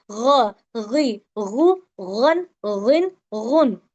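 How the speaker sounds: slow gurgled gh for each ग, गी, गु; baby-gurgle ghain. Shown as a demonstration. Teacher likens it to a baby's gurgle, ग as throaty gh. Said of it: "ये छोटा बच्चा गु, गु करता है ना, वैसा वाला".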